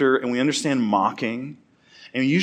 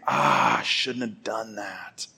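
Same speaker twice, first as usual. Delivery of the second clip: sighed, slow, quiet regret; acted rueful self-reproach; regretful; 3 semitones lower, 5 dB quieter, slower than usual. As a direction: sigh out the opening, then trail softly. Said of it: "It's that idea of regret".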